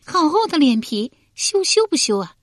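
high, coy teasing voice; giggling tease. A narrator's reading. Concubine flirting with Guan Zhong, high coy tease. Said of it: "笑道".

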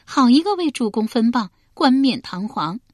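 mock-scolding laugh. A narrator's reading. Concubine teasing Guan Zhong, playful mock scold. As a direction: chide him playfully like a pampered concubine. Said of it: "叶敬嗔笑道".

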